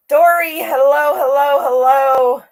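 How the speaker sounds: high, loud singsong hellos; excited greeting. Real, not acted. Host greeting a viewer in the chat, high excited hellos.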